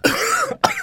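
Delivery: sudden harsh coughing fit; choking cough. Real, not acted. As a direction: cough hard like something went down wrong. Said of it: "I'm choking".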